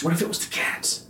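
a soft, worried whisper; nervous hushed question; whispered worry. Retold or imitated. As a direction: whisper it as if scared in the dark. Wife's worried quote in bed.

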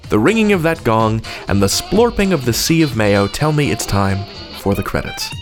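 ordinary delivery

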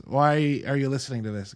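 ordinary talk, nothing marked

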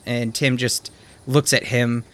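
ordinary delivery

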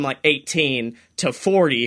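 ordinary talk

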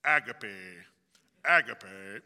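imitating a British lecturer saying agape as AG-uh-peh; C S Lewis British accent. Pastor imitating C S Lewis on tape, British accent. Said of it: "in his thick British accent, he pronounces it".

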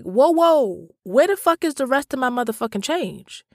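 high-pitched retold outrage; acted-out protest, pitch jumping up; indignant demand. Customer to friend over missing change, indignant.

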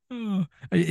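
normal talking voice

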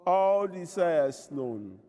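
normal talking voice

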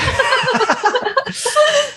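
real laugh that jumps high; hearty laugh. Real, not acted. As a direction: laugh openly along with the guest. Podcast host laughs with guest.

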